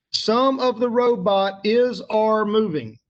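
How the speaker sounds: sentence read out slowly, word by word; slow read-through. Shown as a demonstration. Teacher slowly reading the sentence to students. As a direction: read it slowly so they follow. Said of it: "In this sentence right here".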